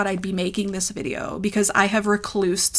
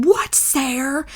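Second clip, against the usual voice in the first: high, drawn-out imitation of angry mother; mock maternal snap, stretched out; mother snapping; 6 semitones higher, 4 dB louder, slower than usual. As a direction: snap the name as an irate mother. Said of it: "like, instantly she snaps".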